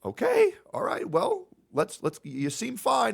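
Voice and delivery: very high, mock-casual paramedic; dismissive paramedic. Paramedic brushing off the patient, high mock voice. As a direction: toss it off in a squeaky medic voice. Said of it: "And they say".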